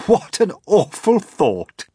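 mock horror. Creatures joking about eating James, high and loud. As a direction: gasp it with playful horror.

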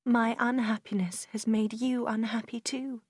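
usual speaking voice

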